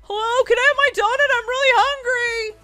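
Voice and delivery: loud, high-pitched hungry whine; impatient whine. Acted. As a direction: wail for service from the line. Hungry customer demands her donut.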